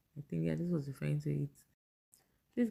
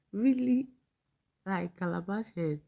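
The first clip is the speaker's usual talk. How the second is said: louder, high-pitched disbelief; incredulous; 4 semitones higher, 4 dB louder than usual. Real, not acted. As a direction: question it loudly, pitch jumping up in disbelief. Reacts in disbelief to a post.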